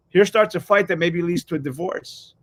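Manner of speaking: normal talking voice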